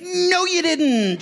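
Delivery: very high, drawn-out singsong call; mock calling out. Pastor answering late arrivals across parking lot, mock calling out. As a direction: stretch each word as if calling across a lot.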